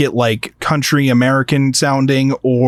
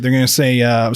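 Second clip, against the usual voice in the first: ordinary talk, nothing marked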